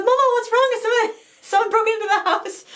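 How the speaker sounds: put-on high worried child's voice. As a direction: voice a little girl rushing to help. Daughter voiced running upstairs.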